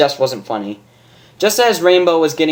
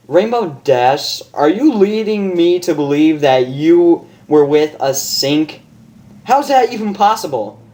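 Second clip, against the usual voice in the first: higher, disbelieving doctor; incredulous doctor; 4 semitones higher, 4 dB louder than usual. Acted. Doctor questioning Rainbow, higher incredulous.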